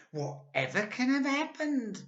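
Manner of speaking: put-on very high, squeaky old woman; Missus Twit. A narrator's reading. Old wife puzzled by her stick, high and squeaky. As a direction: squeak it out like a startled old hag. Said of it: "Missus Twit said".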